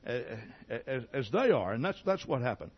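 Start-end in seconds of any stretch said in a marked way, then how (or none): none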